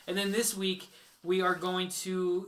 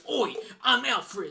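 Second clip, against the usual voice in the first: slow, stretched Cockney Alfred, higher than his own voice; a hammed-up Cockney butler introducing himself; Cockney attempt; 5 semitones higher, 3 dB louder, much slower than usual. Host tries his Cockney as Alfred for friends, slow and hammed-up. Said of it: "I was trying to do my Cockney".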